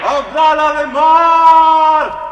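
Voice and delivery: long, slow, high shout across the sea; calling out. Acted. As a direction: stretch each call out over the water. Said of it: "repite en vano su grito".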